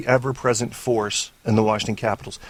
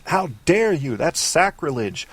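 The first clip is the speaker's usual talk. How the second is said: imitating outraged fans; 5 semitones higher than usual. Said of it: "some of y'all are saying".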